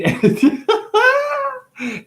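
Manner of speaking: high giggle. Real, not acted. Laughing at own joke mid-bit, high giggle. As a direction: burst of high laughter breaking the quote.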